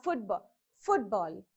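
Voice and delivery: football stressed on FOOT; FOOTball, first half of football prominent, second falling away; first-syllable stress. Shown as a demonstration. Teacher giving compound word example football, first-syllable stress. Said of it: "this is how you have to pronounce correctly".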